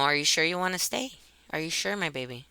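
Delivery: retold soft, coaxing question to a child; tender asking. Mother gently checks with daughter.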